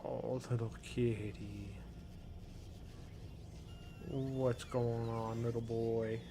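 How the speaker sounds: soft, slow doting coo; hushed tender voice for a pet. Real, not acted. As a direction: murmur slowly to the cat. Cat cuddling on her lap.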